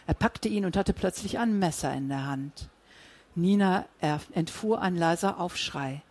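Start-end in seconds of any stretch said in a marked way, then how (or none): none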